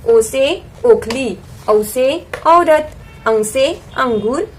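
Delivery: slow, measured alphabet recitation. Shown as a demonstration. Teacher revising vowels with picture words, slow recitation. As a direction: recite each letter and word slowly, with space between.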